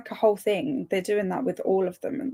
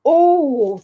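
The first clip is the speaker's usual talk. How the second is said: surprised gasp; 6 semitones higher, 7 dB louder than usual. Real, not acted. Gasp of delight.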